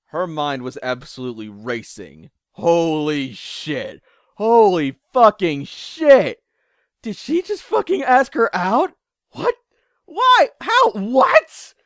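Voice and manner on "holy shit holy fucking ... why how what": rising, ever higher and louder disbelief; shocked shrieking thoughts; frantic inner panic. Acted. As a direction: spiral into a squealing freak-out. Inner panic at being asked out.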